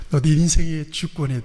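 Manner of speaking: ordinary delivery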